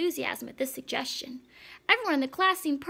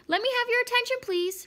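high, louder call for attention; calling to order; 10 semitones higher, 4 dB louder than usual. A narrator's reading. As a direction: ring it out over a noisy class. Repeats call to noisy class.

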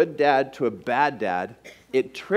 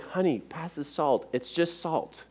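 soft reassurance; 8 dB quieter than usual. Retold or imitated. Husband calms his wife.

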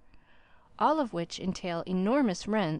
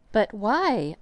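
gasped; 7 dB louder than usual. A narrator's reading. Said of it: "I gasped".

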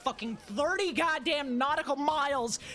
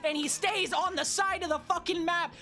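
angry rant; 6 semitones higher than usual. Real, not acted. Ranting at Yoshi in race.

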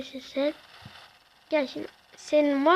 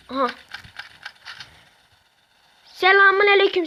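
normal talking voice

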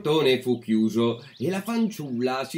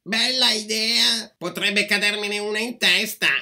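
high-pitched mocking retort; sarcastic refusal; 7 semitones higher than usual. A narrator's reading. Lazy girl refusing to help the apple tree, sarcastic.

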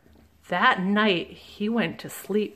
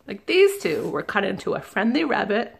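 ordinary delivery

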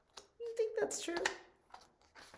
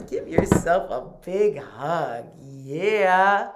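usual speaking voice

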